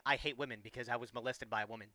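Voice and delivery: hypothetical quote. Retold or imitated. Streamer voicing an absurd example to his chat, softly. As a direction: murmur it as a mock example. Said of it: "me saying".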